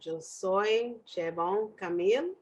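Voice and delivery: slow emphatic restatement; slow clear repeat. Shown as a demonstration. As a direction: spell it out for someone who misheard. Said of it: "I said".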